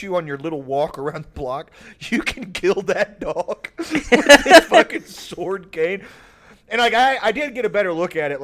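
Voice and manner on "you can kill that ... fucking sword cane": laughing. Real, not acted. Laughing at the sword cane.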